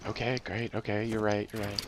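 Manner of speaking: sheepish hushed voice; suddenly hushed. Real, not acted. Hushing after yelling into mic.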